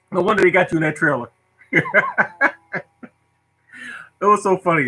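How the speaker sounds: ordinary talk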